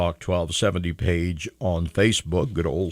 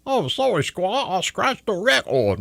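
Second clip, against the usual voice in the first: silly high voice; 10 semitones higher than usual. Pretend.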